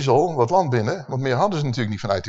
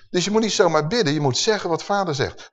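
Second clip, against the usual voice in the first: ordinary talk, nothing marked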